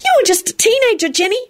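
high-pitched, earnest little professor; serious. A narrator's reading. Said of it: "Flitwick told her seriously".

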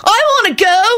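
very high pleading whine; high-pitched begging; loud whine. A narrator's reading. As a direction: whine like you're being left out. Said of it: "Kenny whined loudly".

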